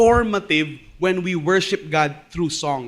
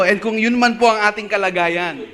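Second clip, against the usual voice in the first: ordinary talk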